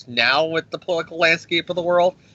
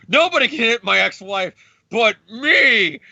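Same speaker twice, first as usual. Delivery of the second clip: raised, higher indignant yell; angry Hank Pym; 7 semitones higher than usual. Retold or imitated. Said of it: "Hank as Giant Man go".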